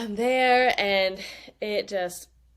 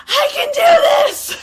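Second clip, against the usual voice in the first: much higher, much louder, slow battle cry; reliving the moment she decided to push; triumphant shout; 15 dB louder, slower than usual. Retold or imitated. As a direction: roar it out as she rises on her knees. Rising up to push in labor.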